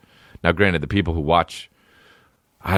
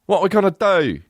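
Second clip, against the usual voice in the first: high British cry of alarm; mock British accent; 12 semitones higher than usual. British actor as a worried Russian, mock British accent. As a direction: whine it in an exaggerated British accent. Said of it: "literally British".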